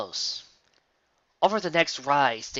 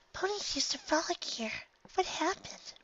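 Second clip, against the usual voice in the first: much higher and much softer, timid; timid soft pony voice; 9 dB quieter than usual. Acted. Shy pony gazing at lonely ruins, soft and much higher.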